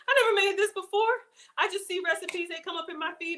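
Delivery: high, bubbly excitement; giddy with excitement over the recipe; excited. Real, not acted. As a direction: gush about it, voice riding high. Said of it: "Y'all, I'm so excited".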